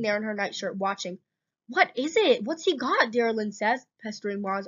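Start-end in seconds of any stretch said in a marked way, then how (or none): 1.7-3.1 high, loud, excited questions; raised, insistent curiosity; eager questioning. A narrator's reading. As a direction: pester eagerly like a curious little sister. Excited sister pestering with questions.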